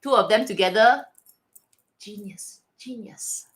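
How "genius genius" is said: hushed whisper, much softer; whispered praise. Real, not acted. Fan whispering praise of the collab, hushed. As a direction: breathe the word in awe.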